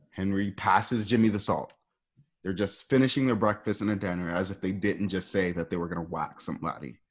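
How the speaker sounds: normal talking voice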